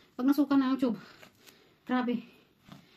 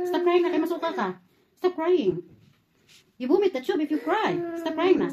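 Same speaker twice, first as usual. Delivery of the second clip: high, raised-voice urging; insistent and pitched up; urgent scolding; 6 semitones higher, 4 dB louder than usual. Real, not acted. Urging crying child to stop.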